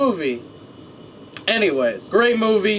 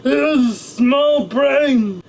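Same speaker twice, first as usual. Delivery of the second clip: put-on drunk guy; 3 semitones higher, slower than usual. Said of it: "he was like".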